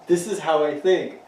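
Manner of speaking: stubborn mock quote. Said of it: "You're like".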